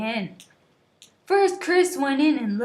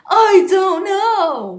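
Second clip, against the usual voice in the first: loud, high, slow yell; frustrated shout; yelling back; 6 semitones higher, 8 dB louder, slower than usual. A narrator's reading. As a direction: yell it back in his face. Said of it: "I yelled back at him".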